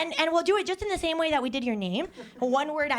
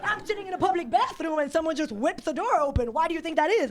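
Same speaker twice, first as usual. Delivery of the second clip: high, rushed arena comic voice; dream stage voice; 7 semitones higher, faster than usual. Pretend. Comic imagining her arena set, fast high stage voice. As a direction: rattle it off fast and high to millions. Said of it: "this is how I talk in my dream".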